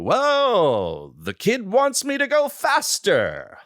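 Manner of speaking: high, slow mocking jeer; mock him loudly for the crew; imitating a co-star jeering to the crew. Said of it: "said out loud to the crew".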